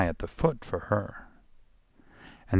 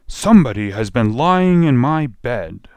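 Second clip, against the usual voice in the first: lightly voiced huge bear, loud and raised; rough, gruff bear; 4 semitones higher, 8 dB louder than usual. A narrator's reading. Huge bear finds his bed used, rough gruff voice. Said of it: "gruff voice".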